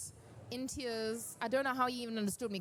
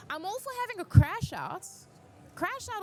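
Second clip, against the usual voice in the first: much higher and louder, bursting with glee; excited outburst; 10 semitones higher, 7 dB louder than usual. Real, not acted. To fellow cosplayers, a loud high gleeful burst. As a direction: cheer the meltdown on, loud and high. Playful endorsement of meltdowns.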